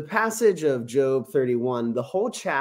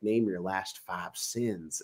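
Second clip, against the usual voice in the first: lightly voiced pastor, low, slow and quiet; slow, hushed mock pastor demanding a confession; low-key imitation of an accusing pastor; imagined pastor; 6 semitones lower, 9 dB quieter, slower than usual. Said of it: "Every time I was around a pastor".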